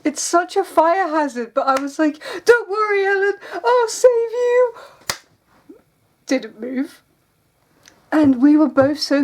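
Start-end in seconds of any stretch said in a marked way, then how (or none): 2.4-4.7 mock heroic rescuer. Vlogger retells rescuing her sister like a movie hero, hammed-up.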